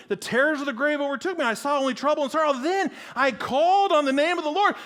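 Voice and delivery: high, loud, frantic protest; indignant yelling. Acted. Disciple protests to Jesus in raging storm, yelling. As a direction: hurl scripture back, climbing to a holler.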